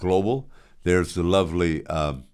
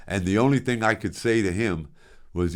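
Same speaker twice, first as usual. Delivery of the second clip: normal talking voice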